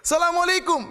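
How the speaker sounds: high, loud salam called out to the statue; loud shouted greeting. Retold or imitated. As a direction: shout the greeting at the idol on purpose. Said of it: "saya sengaja keras". (salam diteriakkan keras dan tinggi)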